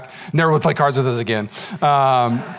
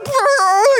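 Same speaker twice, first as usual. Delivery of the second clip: much higher, squeaky stifled giggle; 3 dB louder than usual. Retold or imitated. Boys hiding a prank from a friend, squeaky giggle. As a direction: giggle you can't hold in.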